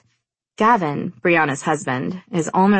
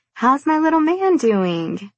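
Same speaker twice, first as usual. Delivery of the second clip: cooing; 10 semitones higher than usual. Acted. Cooing at baby.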